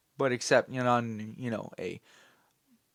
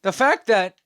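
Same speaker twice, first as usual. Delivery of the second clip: voice crack; 7 semitones higher, 6 dB louder than usual. Real, not acted. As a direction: voice cracking upward on "The fact that". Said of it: "sorry for the voice crack".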